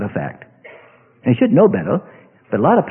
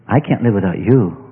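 the same line dropped lower and more heartfelt; lovesick, lower plea; 10 semitones lower than usual. Acted. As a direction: sigh it out like a lovesick sweetheart. Acted lovers' talk, repeated.

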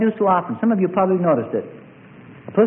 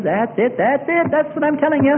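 mock devil; 7 semitones higher than usual. Devil agreeing with the believer.

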